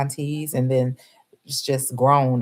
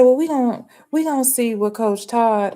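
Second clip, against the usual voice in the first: defensive fan; 7 semitones higher, 4 dB louder than usual. Retold or imitated. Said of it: "She said".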